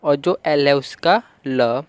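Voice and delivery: ordinary talk, nothing marked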